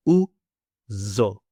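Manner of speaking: "Uzo" split into u, pause, long zzo; syllable by syllable. Shown as a demonstration. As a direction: sound out "Uzo" slowly with a buzzing z. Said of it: "I'll say it for you now".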